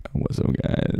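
groggy, just-woke-up greeting; morning voice. Pretend. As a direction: sound like you just rolled out of bed. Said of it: "morning voice".

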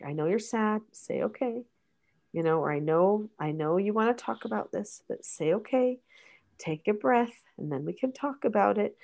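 soft, coaxing parent voice; mother gently coaxing her upset child, softly; gentle prompt to child. Acted. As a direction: softly coach a sad child to calm.

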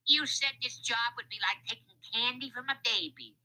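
high, irate crook voice; angry. A narrator's reading. Lefty reproaches Louis for the job, angry. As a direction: complain angrily in a high crook's voice. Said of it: "Lefty said in an angry way".

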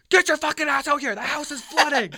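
high, full-volume shout through a door; loud, high, frantic order; urgent yelling. Acted. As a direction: yell at your brother to get out now. Acted yelling at brother through door.

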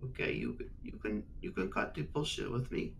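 hushed secret whisper. Acted. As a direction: lean in and whisper. Lord whispers conspiratorially.

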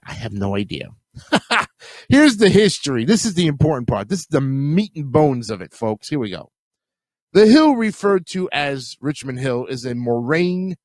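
normal talking voice

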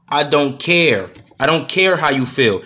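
very slow, flat mock declaration; lightly voiced wife, slowed right down; imitating a blunt wife. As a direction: drag out a mocking I don't care. What wife should say out loud.

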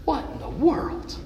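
high, disbelieving gasp of a question; stunned surprise. Acted. Crowd stunned by Jesus touching the bier, surprised. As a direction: sound like the record just skipped.

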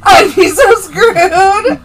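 high, loud, laughing cry on "so". Real, not acted. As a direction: wail "so screwed" through laughter. Repeats the line, stressing so.

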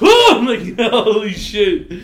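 high, loud laugh through the words. Real, not acted. Laughing recalling the elbow.

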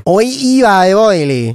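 slow, drawn-out theatrical wail; mock lament. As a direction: ham up the despair, slowly. Mock lament over bad joke.

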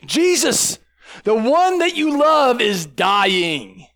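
high, drawn-out mock wail; anguished plea. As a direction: plead slowly, hammed-up desperation. Acts out sisters' deathbed plea.

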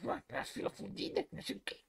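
hushed, whispery imitation of grumbling; muttered complaint. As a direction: mimic her grumbling under your breath. Mimicking annoyed partner's muttered complaint. (imitando reclamação sussurrada)

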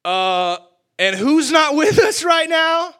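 high, loud, slow shout; frightened disciple yelling; panicked shout. Acted. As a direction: yell the question in alarm.

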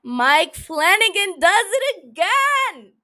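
loud, slow, drawn-out excited yell. Real, not acted. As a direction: shout it out like huge news. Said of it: "I'm yelling into your little headphones".

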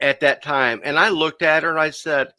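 usual speaking voice

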